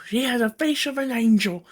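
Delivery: high, fragile, pleading Merrick impression; John Merrick voice. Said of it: "I'm sorry to do this voice".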